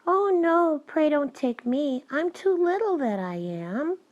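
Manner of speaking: high, pleading little goat; small goat voice. A narrator's reading. Youngest goat begs the troll for mercy, small and high. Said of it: "with such a small voice".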